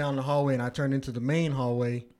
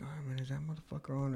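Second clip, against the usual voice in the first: sneaky whisper; 9 dB quieter than usual. Retold or imitated. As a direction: whisper like you might set it off. Sneaking up on the alarm.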